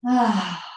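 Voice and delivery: loud, slow "ah" sigh; slow sighing exhale. Shown as a demonstration. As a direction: let one long breath go audibly. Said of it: "One more slow one of those".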